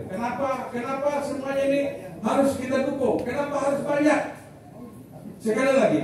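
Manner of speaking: rising, high-pitched rallying oratory, each line climbing; soldier's forceful speech. Real, not acted. Retired soldier rallying Dayak leaders to come to Sampit, forceful climbing oratory. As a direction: hammer the repeats like a commander rallying troops. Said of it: "Karena saya ini tentara, jadi ngomongnya seperti ini".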